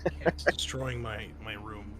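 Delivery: ordinary talk, nothing marked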